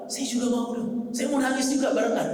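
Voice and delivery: high-pitched imitated plea to join in; eager pleading. Umar wanting to cry along with his companions, pleading. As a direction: plead to be included, voice lifted. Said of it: "kata Umar".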